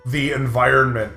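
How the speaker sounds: normal talking voice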